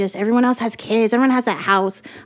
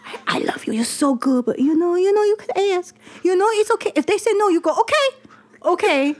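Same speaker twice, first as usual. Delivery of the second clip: higher, mock Chinese-accented mom voice; imitating Chinese mom; 6 semitones higher than usual. Mom consoles her daughter over the agent fee, higher put-on accent. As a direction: play the fussing immigrant mom. Mom blames herself, advises haggling.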